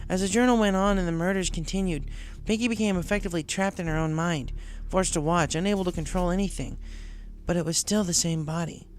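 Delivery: tomboy pony; Rainbow voiced.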